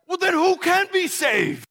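high, drawn-out bewildered question; stunned, protesting question; exasperated disbelief. Acted. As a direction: ask it high and slow, completely at a loss. Stunned disciple questions Jesus.

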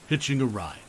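plain reading voice

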